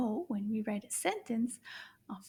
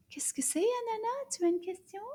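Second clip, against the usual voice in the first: gentle question; 10 semitones higher, faster than usual. Acted. Talking to puppet Anana.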